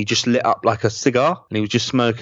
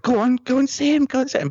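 much higher, eager push of a father; dad urging. Retold or imitated. Dad urging his starstruck son toward Pertwee, high and eager. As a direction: nudge him forward, pitched way up.

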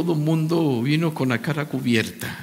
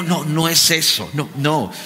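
ordinary talk

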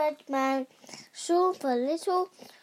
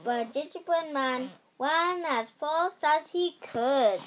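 ordinary delivery, nothing marked

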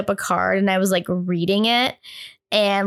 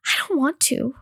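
put-on small, higher-pitched reluctant kid; scared; 4 semitones higher than usual. Child facing the closet door, imitated frightened reluctance. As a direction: balk in a little scared child's voice. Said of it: "I was, like, so scared".

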